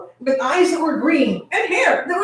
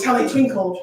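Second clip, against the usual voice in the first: ordinary delivery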